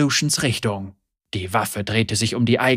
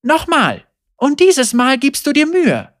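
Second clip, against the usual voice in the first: higher, louder demand; insistent Senna; 13 semitones higher, 6 dB louder than usual. A narrator's reading. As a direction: order him to try properly.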